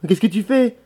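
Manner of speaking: high, loud pressing question; exasperated, rising challenge; insistent demand. Acted. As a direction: press him sharply for an answer. Maid presses him for answer.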